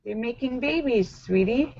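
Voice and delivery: softly voiced patient mother; mom voice. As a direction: answer a child gently and quietly. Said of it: "Awkward conversations with your children".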